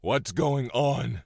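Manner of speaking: rising into loud panicked confusion; alarmed disbelief. Acted. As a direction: voice climbs in shock. Father realises the trick.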